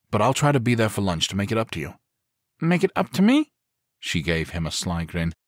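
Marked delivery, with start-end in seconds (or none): 2.6-3.5 put-on high, loud child's question rising; little girl voice. A narrator's reading. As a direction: jump on the promise like a sly little girl. Daughter seizes on dad's promise.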